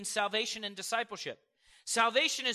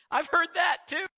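loud, high burst; preacher shouting to his congregation; shouted aside; 8 semitones higher, 7 dB louder than usual. Real, not acted. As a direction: throw it out to the crowd.

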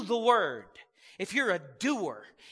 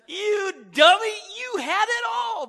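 much higher, much louder taunt; mocking taunt; 11 semitones higher, 10 dB louder than usual. Shown as a demonstration. Pastor jeering at the devil, loud and high. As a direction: jeer at the devil.

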